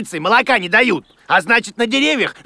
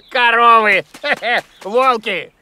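laughing out the words, a bit louder; 2 semitones higher, 3 dB louder than usual. Acted. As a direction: chuckle through both words, amused by the mistake. [name] laughs at the correction. (со смехом)

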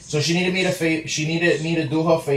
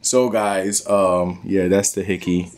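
lower, relaxed outro to the camera; vlog outro; 8 semitones lower than usual. Acted. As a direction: wrap it up for viewers.

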